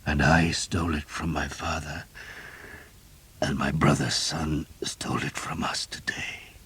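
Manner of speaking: soft, fading voice sinking to a whisper; softly spoken. A narrator's reading. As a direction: speak it softly, strength ebbing. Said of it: "He spoke softly".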